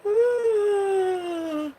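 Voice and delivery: long, very high yawning sigh; a huge contagious yawn. Real, not acted. Streamer yawning again on air, long high yawn.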